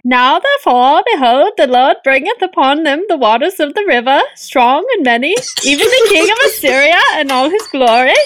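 lilting, botched Swedish-like reading; Swedish-sounding urchin accent. Acted. Co-host attempts an urchin reading, lilting Swedish-sounding accent. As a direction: swing the scripture up and down in fake Swedish. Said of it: "you're sounding more like Swedish".

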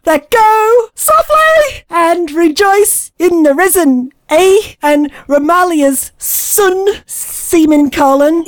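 put-on slow, drawn-out botched Canadian-Irish accent; bad fake Canadian. Sister struggling with a North American accent, bad fake Canadian. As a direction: labour every word in a terrible Canadian put-on. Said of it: "trying to sound shit".

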